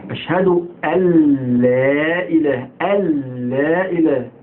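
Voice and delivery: أن لا merged into a long "al-laa"; assimilated nun. Shown as a demonstration. Teacher showing the merged nun, careful chanted pronunciation. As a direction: drop the n of أن into a held l of لا. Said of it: "مضغمة في اللام".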